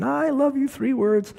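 high, hammed-up drunken pleading from below the window; mock-bellow it up to her window; drunk husband's bellow. Drunk husband pleading up to his wife's window, hammed-up high bellow. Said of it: "he started bellowing".

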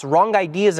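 ordinary delivery

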